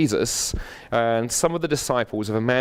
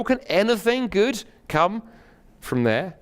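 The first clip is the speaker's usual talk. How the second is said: slow, high opening that drops away at the end; sceptical exclamation; 10 semitones higher, slower than usual. A narrator's reading. Sceptical friend doubts anything good from Nazareth, incredulous exclamation. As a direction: question it with drawn-out disbelief. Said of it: "exclaimed Nathanael".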